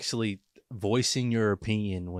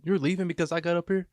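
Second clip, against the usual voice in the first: mock offended coworker; 9 semitones higher than usual. Mimicking coworker at work.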